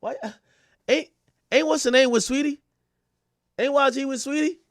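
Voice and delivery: high-pitched, baffled protest; Sweetie. Pretend. Sweetie asking why she got dragged in, high mock bafflement. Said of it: "Sweetie like".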